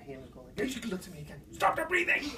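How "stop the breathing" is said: much higher, squeezed voice; strained hushed order. Retold or imitated. Hunter insists to his partner mid-stalk, high strained voice.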